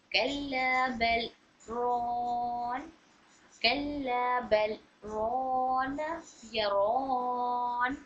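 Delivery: slow recitation with a strong echoing bounce on the stopped letter; qalqala recitation demo. Shown as a demonstration. Teacher demonstrating strong qalqala to students, slow. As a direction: draw out कल्ला बल रोन and let the letter bounce. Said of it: "कलकलाए कुबरा हो रहा है".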